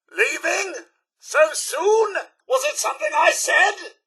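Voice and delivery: ordinary delivery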